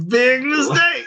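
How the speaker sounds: high, loud cry of big mistake; mock big outburst. Telling the workers with a high shout. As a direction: shout it up high, half laughing. Mocking them as he tells it.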